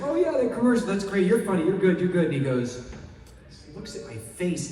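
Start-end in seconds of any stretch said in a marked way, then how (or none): 0.0-2.3 high, loud breezy praise; casting boss praise. Retold or imitated. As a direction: toss off quick compliments. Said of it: "John Papsdare's like".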